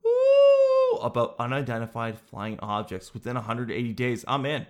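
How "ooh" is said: very high, loud delighted ooh; excited ooh. Real, not acted. Host squeals at the Pentagon UFO briefing. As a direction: squeal with glee. Reading UFO disclosure news.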